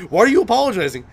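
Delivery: loud, high-pitched imitation of exasperation; exasperated retort. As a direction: demand it back, mock-exasperated. Acted exasperated reply to apology.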